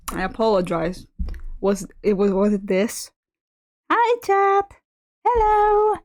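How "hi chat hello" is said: much higher, squeaky cartoon hello; high squeaky greeting.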